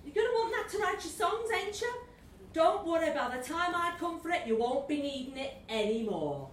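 high, fast, sly Scouse devil; sneering Liverpool accent. Acted. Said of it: "was a Scouser".

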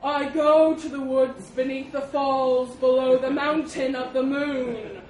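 slow, loud, drawn-out proclamation; trumpeting elephant. A narrator's reading. Elephant announcing where he goes, loud trumpeting. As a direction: boom it out like a self-important elephant. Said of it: "the elephant trumpeted".

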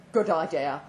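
mildly acted older man; lightly voiced Gillespie. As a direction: give his reply a light older-man colour. Man's reply voiced in story.

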